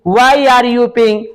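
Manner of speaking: each word slow and spaced; teacher spelling out a sentence; slow dictation. Shown as a demonstration. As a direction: dictate it slowly for the class. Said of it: "slow dictation of English".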